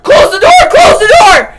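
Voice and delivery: high, loud mock shout of close the door. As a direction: yell it high as a joke warning. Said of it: "I was like".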